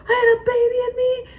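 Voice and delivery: high put-on voice, pleading; mock protest. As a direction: squeal it in defense. Retorting to viewers' comments.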